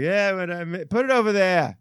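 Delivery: lightly voiced old barkeep; old lady behind the bar. Host retells the old lady behind the bar, lightly voiced. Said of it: "the old lady behind the bar was like".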